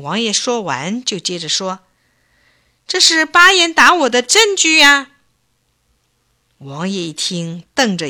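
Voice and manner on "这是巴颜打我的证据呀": loud, much higher exclamation; old man interrupting the prince with his proof; triumphant retort. Acted. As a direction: cut in and present the proof proudly.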